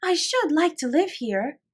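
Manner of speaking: put-on high, slow little girl's wish; long for it like a small child; Lottie's voice. A narrator's reading. Lottie tells Sarah she wants to live there, high and slow.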